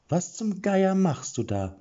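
high, slow, sharp challenge; annoyed demand. A narrator's reading. Killer wakes and confronts Erik, high and sharply annoyed. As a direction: catch him in the act and demand answers. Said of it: "der Tonfall war keineswegs einer, zum, der zum Weitermachen animierte".

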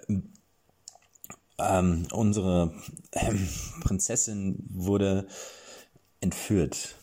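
halting, slowed speech full of ums; stammering. Acted. Knight stammers at the bloody crown. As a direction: stumble over every word. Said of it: "stammelte Erik".

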